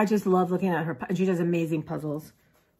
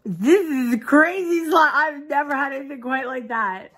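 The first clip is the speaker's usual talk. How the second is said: amazed; 6 semitones higher, 3 dB louder than usual. Real, not acted. Amazed by slime.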